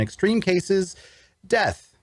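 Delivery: high-pitched dramatic climax; pause, then land the last word high. A narrator's reading. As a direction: melodramatic build to DEATH. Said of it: "maybe that last one's a bit dramatic".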